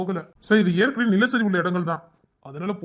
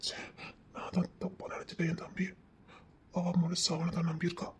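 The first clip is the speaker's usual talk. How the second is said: hushed whisper to himself; 13 dB quieter than usual. Acted. Regretful man whispering to himself about the couple. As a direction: breathe the words out, barely audible, half to himself.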